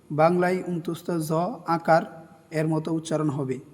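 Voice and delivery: normal talking voice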